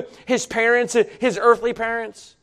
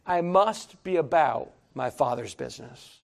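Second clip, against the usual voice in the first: softer, solemn voice for Jesus; preacher quoting Jesus to the church, softly and solemnly; quiet solemn quote; 8 semitones lower, 11 dB quieter than usual. Retold or imitated. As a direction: speak it low and reverent. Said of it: "He said".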